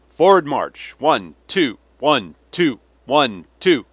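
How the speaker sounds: high, loud drill command; calling marching orders. A narrator's reading. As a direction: call the cadence like a drill sergeant. General calls out a drunken march.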